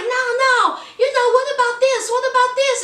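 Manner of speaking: high, fast, eager pitch of Robert. Retold or imitated. Robert urging Annie to look at clothes, high fast eager. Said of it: "he was like".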